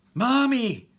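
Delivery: high, loud scream of Mommy; child's scream. Acted. Little girl screaming for her mother, loud and high. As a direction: shriek it like a scared little girl. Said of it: "Her scream carries all around".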